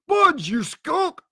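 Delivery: high, slow, drawn-out scorn; scornful cry. A narrator's reading. Blind man scorns the crewman's warning, crying out angrily. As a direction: throw the word back in outrage. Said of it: "cried Pew".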